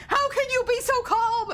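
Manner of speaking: high, loud disbelieving cry; panicky brother. Retold or imitated. Sister imitates her panicking brother, high and loud. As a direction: squeak it out like a frantic little brother. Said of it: "And he's like".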